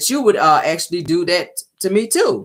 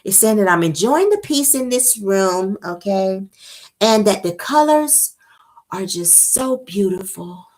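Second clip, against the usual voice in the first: high, slow, airy-calm imitation; 5 semitones higher than usual. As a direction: float it out serenely, slowly. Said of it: "in that same tone".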